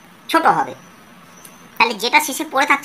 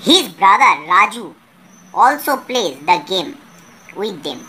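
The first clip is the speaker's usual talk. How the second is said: slow reading aloud; 2 semitones lower, 3 dB louder, much slower than usual. Shown as a demonstration. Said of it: "teacher reads English passage aloud".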